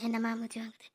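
panting after running, voice almost gone; out of breath. Acted. Panchavarnam arrives running, out of breath. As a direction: gasp it low and soft, breathless. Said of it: "எனக்கு கேக்குதுமா நீ மூச்சு விடுற sound".

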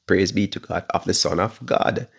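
normal talking voice

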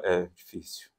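low, soft, absent-minded mumble; reply as if not listening; uninterested reply. Acted. Listener who tuned out answers the talker, absent-minded and low. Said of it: "respostas de quem não está te ouvindo".

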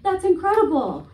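high, loud mock-amazed sarcasm; sarcastic comeback. Comic's imagined comeback to the dog owner, high sarcastic outrage. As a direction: fake amazement, building to outrage. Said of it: "what I should have said was".

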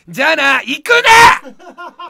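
very slow, loud comic shout; hammed-up shout; drawn-out mock yell. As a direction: stretch the farewell into a fake yell. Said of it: "切れる生配信".

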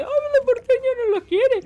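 high loud whiny mock quote; mock complainer. Streamer mocks viewers complaining about porteños, high and loud. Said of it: "no me empezás acá los, a decir".

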